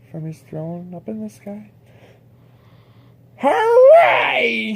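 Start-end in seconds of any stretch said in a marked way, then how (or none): 3.4-4.7 much higher, loud triumphant cry; shouted hooray. Acted. The king cheers from forty miles high, shouting. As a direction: whoop with triumph. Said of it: "shouted Yertle".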